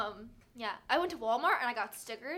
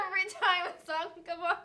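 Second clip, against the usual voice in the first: usual speaking voice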